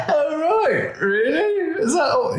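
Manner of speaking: very high squeaky voice through laughter; dad hearing about gallon pints while driving, squeaky strangled laugh; strangled mock calm. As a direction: fake calm while panicking inside. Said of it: "with a Richter screen going".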